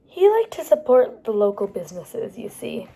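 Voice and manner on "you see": usual speaking voice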